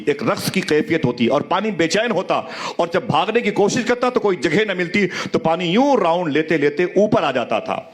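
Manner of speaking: storytelling preacher, voice rising and falling with emotion; impassioned sermon. Acted. Preacher to the gathered congregation, impassioned storytelling. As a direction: tell it to move the congregation to tears.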